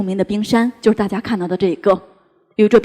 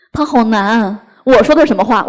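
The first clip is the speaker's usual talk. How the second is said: ordinary talk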